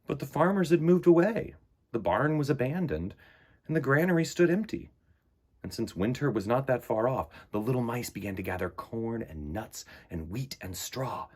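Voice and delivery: unhurried picture-book narration; storybook reading. Acted. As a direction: read it like story time for little ones. Reads picture book aloud.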